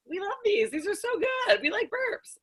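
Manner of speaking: high, squeaky gushing fan voice; imitating gushing fans. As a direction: gush like an excited fan. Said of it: "They're like".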